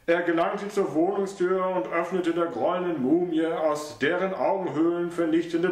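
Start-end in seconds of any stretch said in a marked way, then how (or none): none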